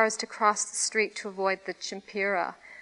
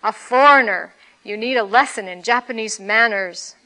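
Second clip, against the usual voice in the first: put-on much louder, a drunk roaring in fury; roared; 8 dB louder than usual. A narrator's reading. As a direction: roar the threat at the foreigner. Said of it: "he roared".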